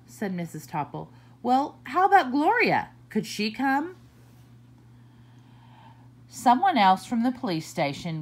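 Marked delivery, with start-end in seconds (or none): 1.4-4.0 higher, hopeful ask; lightly voiced principal, hopeful. Principal asks about Gloria.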